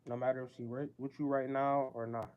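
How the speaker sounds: ordinary talk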